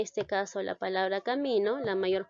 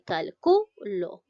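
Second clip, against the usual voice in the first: "calculo" slowed, punching cal-CU-lo; drawn-out calculo, final lo dropped lighter; stress on CU; slower than usual. Shown as a demonstration. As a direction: sound out calculo with a strong middle CU. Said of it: "recae en la sílaba".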